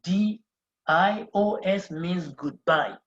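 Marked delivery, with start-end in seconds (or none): 0.0-1.9 louder, slower letter-by-letter spelling; teacher spelling adios for students; spelled out loud. Shown as a demonstration. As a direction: call out each letter clearly.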